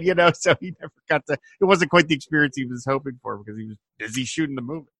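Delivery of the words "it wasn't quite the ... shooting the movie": normal talking voice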